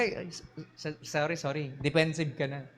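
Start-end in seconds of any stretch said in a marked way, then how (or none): 0.0-1.7 meek apology. Retold or imitated. Bumped person apologizing softly, meek and sorry. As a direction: shrink back and apologize gently. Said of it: "natabig ka".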